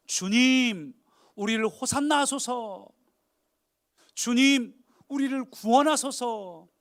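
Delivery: loud, high, drawn-out plea; slow impassioned crowd cry. Acted. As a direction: cry to the Lord for rescue, stretching the endings. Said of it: "그렇게 외치고 있는 장면입니다".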